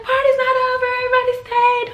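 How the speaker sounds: rally everyone to stay; excited announcing shout. Retold or imitated. Teen hostess calling to her guests, shouting excitedly. Dad overrides mom, she announces.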